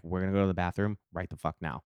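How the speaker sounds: quick, low, hushed insistence; retold urgent undertone; covert order. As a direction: order a friend aside, fast and soft.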